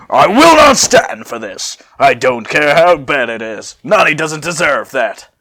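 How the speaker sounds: loud, slowed, defiant shout; shouting back. Acted. Sissel shouting back at Trunks to defend Nani. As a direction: stand up to him at full voice. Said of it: "Sissel shouts back at him".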